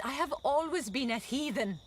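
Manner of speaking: usual speaking voice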